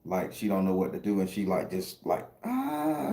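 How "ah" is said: thin, very high mock scream; a high-pitched 'ah' standing in for a scream; imitated scream. Woman screaming with flowers in each hand, imitated high scream. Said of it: "screaming with two flowers in each hand".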